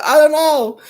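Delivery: high, loud distressed yell; panicked yell. Retold or imitated. Girlfriend answering her boyfriend from the nightmare, yelling high and loud. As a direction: cry it out, still in the nightmare. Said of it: "I go".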